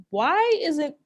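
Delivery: ordinary talk, nothing marked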